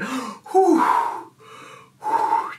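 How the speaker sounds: long blown-out exhales; deep calming breaths. Real, not acted. Vlogger getting it together after giggles, exhaling deeply. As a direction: blow air out to recover from laughing. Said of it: "Deep breaths".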